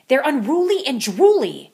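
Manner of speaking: hammed-up, high and loud; dramatic. A narrator's reading. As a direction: play up the drama. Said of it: "she added dramatically".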